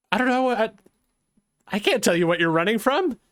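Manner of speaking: a lightly voiced nervous guard, rambling in fear; a hint of a scared lookout caught alone; frightened guard.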